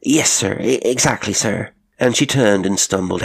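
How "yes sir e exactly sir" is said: higher, stammering yes; flustered obedience. A narrator's reading. Cook accepting orders from the colonel, flustered. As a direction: stammer agreement to the master.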